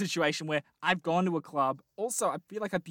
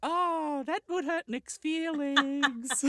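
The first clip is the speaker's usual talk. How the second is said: high falsetto, drawn out slowly; mock lady; 10 semitones higher, much slower than usual. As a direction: coo it as the put-on girl. Still playing the lady.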